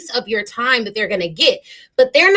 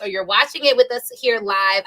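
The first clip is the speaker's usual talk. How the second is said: usual speaking voice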